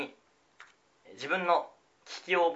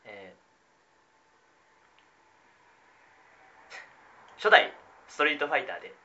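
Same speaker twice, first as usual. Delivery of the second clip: normal talking voice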